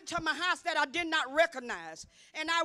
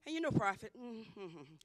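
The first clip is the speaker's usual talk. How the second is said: ordinary talk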